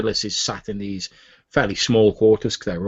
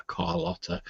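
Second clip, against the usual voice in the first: Carlotta sighed, much softer and slower; sighed name; 3 semitones lower, 11 dB quieter than usual. Acted. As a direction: let Carlotta fall away on a sigh.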